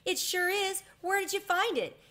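thrilled to see his toy again; excited. A narrator's reading. Boy gets lost toy back.

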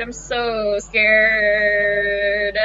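slow, stretched whimper of fear; drawn-out scared whimper. Real, not acted. Player dreading the character's return, slow scared whimper. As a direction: stretch every word out like a frightened moan. Repeats line, second drawn out.

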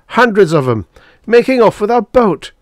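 loud, high-pitched alarm; alarmed gasp. A narrator's reading. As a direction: raise the alarm at full pitch. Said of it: "Drummond gasped".

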